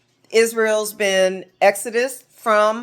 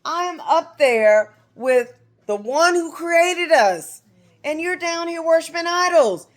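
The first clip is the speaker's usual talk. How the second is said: high, indignant retold rebuke; acted-out exasperated scolding; furious Moses; 9 semitones higher than usual. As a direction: protest in disbelief, pitch held way up. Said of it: "So he was furious".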